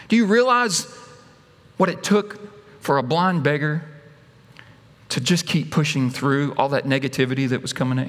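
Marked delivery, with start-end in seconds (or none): none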